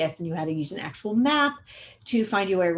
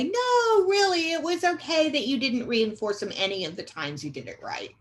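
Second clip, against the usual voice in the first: high, sing-song fake reassurance sliding down; mock-soothe them in an exaggerated high voice; hammed-up letting someone off the hook; falsely reassuring; 7 semitones higher, 3 dB louder than usual. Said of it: "being unkind when you say".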